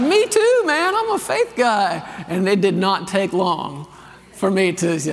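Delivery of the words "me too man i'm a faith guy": excited. Retold or imitated. Retelling his excited reply.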